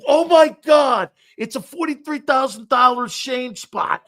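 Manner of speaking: mock panic on discovering he's on air; panicked shout. Host realizing he's live on air, panicked shout. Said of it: "I go".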